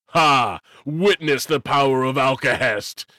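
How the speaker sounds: loud gloating villain shout; triumphant cry. A narrator's reading. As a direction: crow it out in triumph. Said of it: "cried Alcahest".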